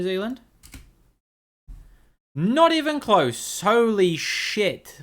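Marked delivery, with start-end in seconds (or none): 2.3-5.0 high and slow, drawn out in shock; stunned disbelief. Real, not acted. Streamer sees his guess miles off, shocked and slow. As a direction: let the jaw drop on every word.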